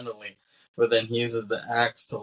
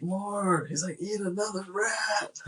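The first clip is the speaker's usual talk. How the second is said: high, slowed, gleeful demand; 10 semitones higher, 3 dB quieter, slower than usual. Retold or imitated. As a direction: goad him like a cackling showman. McKay demanding more.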